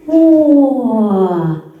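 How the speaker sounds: usual speaking voice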